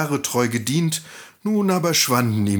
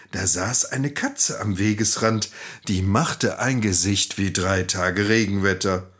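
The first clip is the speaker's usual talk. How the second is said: ordinary delivery, nothing marked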